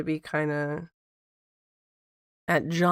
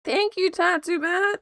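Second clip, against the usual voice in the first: excited thanks; 12 semitones higher, 4 dB louder than usual. Real, not acted. Thanking a chat donor.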